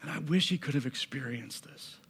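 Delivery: soft and low, with long pauses; hushed, aching. Acted. Preacher linking a grieving sister's wish to Naomi, hushed. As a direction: let the wish sink into near silence.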